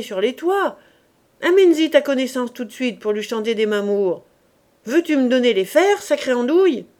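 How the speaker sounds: loud angry scolding; a roofer shouting at his idle apprentice; furious. Acted. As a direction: tear into him for dawdling. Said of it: "dit Coupeau furieux".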